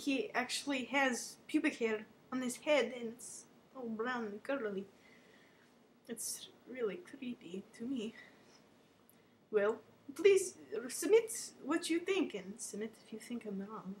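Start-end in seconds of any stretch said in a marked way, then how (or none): 6.1-8.1 breathy hushed aside, no voice at all; creeped-out whisper. Pretend. Pretend host whispering to viewers, creeped out. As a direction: lean in and whisper it like a secret.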